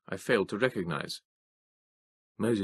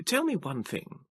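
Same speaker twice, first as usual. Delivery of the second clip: Moses demanding; 8 semitones higher, 3 dB quieter than usual. A narrator's reading. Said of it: "Moses demanded".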